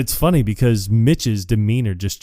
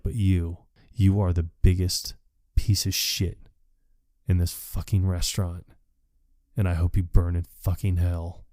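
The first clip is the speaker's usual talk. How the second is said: quieter, deliberate insult with spaced phrases; measured contempt, retold; 3 semitones lower, 5 dB quieter than usual. Departing worker insults the cook, restrained imitated contempt. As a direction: deliver the damning verdict low-key and deliberately.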